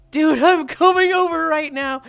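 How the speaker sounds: loud, high excited yell; excited shout. Real, not acted. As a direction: blurt it out eagerly. Excited reply about cashews.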